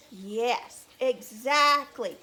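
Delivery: plain reading voice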